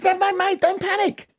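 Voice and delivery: calm him down in a squeaky stranger's voice; squeaky stranger at the bar soothing the drunk; other bloke at the bar. Acted. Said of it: "another bloke comes up and says".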